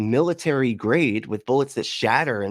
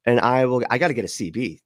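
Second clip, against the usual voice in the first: usual speaking voice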